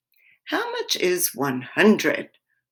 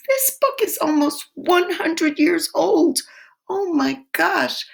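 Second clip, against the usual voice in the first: amazed; 12 semitones higher, 3 dB louder than usual. Real, not acted. Teacher marvelling at old book.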